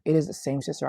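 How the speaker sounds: normal talking voice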